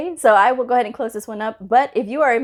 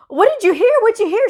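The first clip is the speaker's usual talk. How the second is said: high, louder mock-alarmed question; startled questioning; 14 semitones higher, 5 dB louder than usual. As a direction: demand the rumor, hammed up. Acted alarmed reply to gossip.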